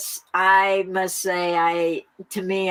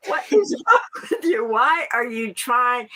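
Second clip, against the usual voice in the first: laughing mock scold; 5 semitones higher than usual. Woman mocking her own worry, laughing high. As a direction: scold yourself, cracking up.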